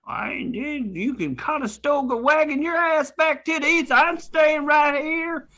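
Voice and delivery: put-on a cranky old prospector griping; cowboy prospector. S0 plays a Western prospector.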